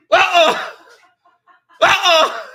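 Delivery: high, loud, laughing uh-oh. Real, not acted. Streamer greets cousin Midnight joining, laughing teasingly. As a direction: tease the new arrival through a laugh.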